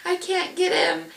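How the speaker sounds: high, desperate cry of a mother in labor; panicked scream. Retold or imitated. Mother in labor crying to the nurses, high and desperate. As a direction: wail that she can't do it. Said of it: "I was screaming".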